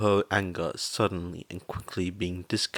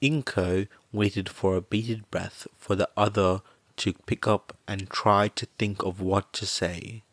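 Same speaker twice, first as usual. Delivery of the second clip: usual speaking voice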